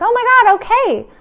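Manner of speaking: a breathless good overwhelm; overwhelmed delight. Pretend. Acted overwhelmed happy reaction.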